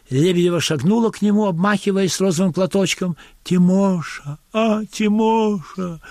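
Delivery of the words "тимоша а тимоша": drawn-out, sing-song calling of a name; a lightly played peasant woman stretching the name; coy village woman calling. Amateur actress calling her husband, drawn-out and coy. Polina calls Timofei at rehearsal.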